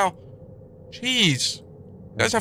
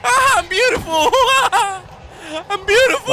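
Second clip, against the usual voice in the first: high giggly delight; laughing; 11 semitones higher than usual. Real, not acted. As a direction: laugh through the words. Laughing at own new look.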